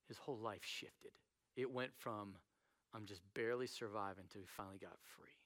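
low, very soft, intimate confiding; hushed sincerity. Real, not acted. Preacher empathizing with suffering churchgoers, hushed and sincere. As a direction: drop right down and speak gently from pain.

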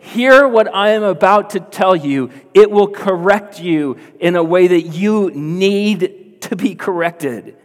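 high, loud preaching in Jesus' words; urgent appeal. Retold or imitated. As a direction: press every word home forcefully. Voicing Jesus demanding to be heard.

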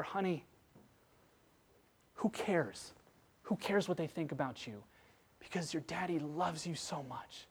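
very soft, gentle reassurance; tender fatherly comfort. Acted. Dad consoling his crying daughter, hushed and tender. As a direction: soothe her softly, close and loving.

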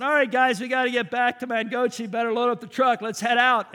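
loud call to the group. Retold or imitated. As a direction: round everyone up to leave. Calling the crew to leave.

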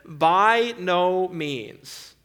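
very slow, every word of "By no means" weighted; solemn, drawn-out "By no means"; emphatic. Acted. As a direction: draw out "By no means" firmly and slowly. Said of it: "Very emphatically, he says".

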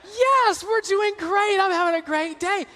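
high, sugary phone greeting; sweet Southern belle. Retold or imitated. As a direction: switch instantly to syrupy charm. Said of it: "she sounds like a sweet Southern belle".

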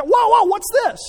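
high, loud hammed-up "whoa, whoa"; mock startled parent. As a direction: play a parent catching a kid, exaggerated. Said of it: "God, t".

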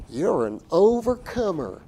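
high, slow Southern prosperity-preacher drawl; mock Southern accent, swooping up on the big word. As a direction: draw it out like a loud Southern preacher. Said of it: "why they have that southern accent".

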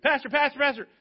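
high, loud calls from the back of the church; calling out loud. Retold or imitated. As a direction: shout for the pastor across the room. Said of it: "not a very loud guy ever".

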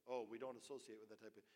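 lightly voiced sniffy church member, fast; snooty dismissal. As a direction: brush the stranger off, a touch prim. Voicing snobbish church members.

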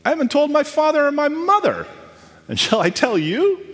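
high-pitched incredulous comeback; acted disbelief, voice leaping up; indignant retort. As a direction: throw it back as an offended question. Acts Samson's indignant reply to wife.

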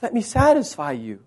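soft, spaced-out invitation; hushed, unhurried appeal; Jesus inviting. Acted. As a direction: voice Jesus gently, with long pauses. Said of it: "And he says".

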